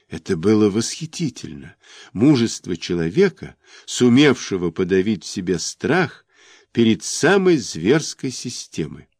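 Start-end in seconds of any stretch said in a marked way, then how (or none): none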